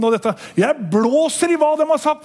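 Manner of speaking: ordinary delivery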